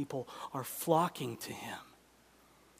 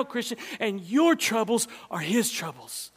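ordinary talk

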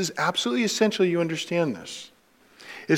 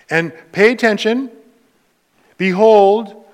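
raised, louder preaching read of the gospel; scripture reading; 4 semitones higher, 5 dB louder than usual. Acted. Pastor reading Luke to the church, proclaiming. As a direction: proclaim the passage to the congregation. Reads Luke thirteen aloud.

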